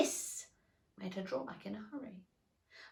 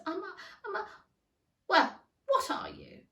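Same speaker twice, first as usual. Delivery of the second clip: flustered; 11 semitones higher, 6 dB louder than usual. A narrator's reading. Alice flustered.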